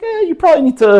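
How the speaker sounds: very high put-on woman's voice; high-pitched, hedging female chatbot; ChatGPT. Speaker mimics the AI's verdict on university, high mock woman's voice. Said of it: "like, a woman's voice in Chat G P T".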